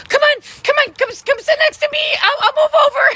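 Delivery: very high, loud, hammed-up urging; eager high shout. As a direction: beg her to sit beside you. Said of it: "I was like".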